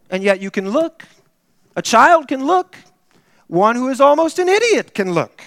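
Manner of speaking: hammed-up rustic preacher; plain old preacher. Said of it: "He said".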